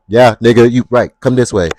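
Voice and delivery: a manager with spunk summoning the line; bossy manager call. Retold or imitated. Manager calling a customer over, loud and bossy. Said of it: "So now I'm, I'm talking different".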